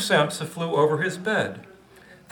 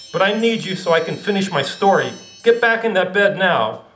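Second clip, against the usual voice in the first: louder, commanding author; stern author voice; 5 semitones higher, 5 dB louder than usual. Acted. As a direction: order the bug back to bed. Kafka commanding.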